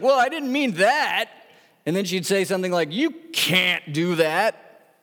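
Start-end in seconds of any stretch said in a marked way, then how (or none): 0.0-1.3 high-pitched mock protest; put-on higher voice, protesting; indignant lady. Acted indignant lady objecting. 2.9-4.9 slow, drawn-out mock objection; indignant lady. Acted lady protesting, drawn out.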